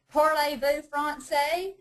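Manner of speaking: lightly hammed Southern-accented French for Parlez-vous français; mock Georgia-French. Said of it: "whacked out French".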